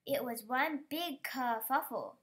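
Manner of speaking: usual speaking voice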